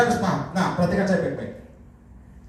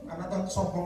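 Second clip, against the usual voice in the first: ordinary delivery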